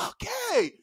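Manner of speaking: high, soft pupils' chorus; small, dutiful high voice; obedient class. Retold or imitated. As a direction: imitate children answering in unison. Class answers teacher.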